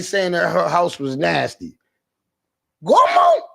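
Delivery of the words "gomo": very high, loud yell of her name; yelling for grandma. Acted. Grandson hollering for his grandma, high full yell.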